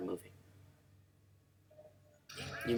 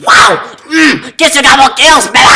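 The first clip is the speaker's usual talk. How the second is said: loud triumphant whoops; excited cheering; 9 semitones higher, 15 dB louder than usual. Real, not acted. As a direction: yell it out in triumph. Cheering after double kill.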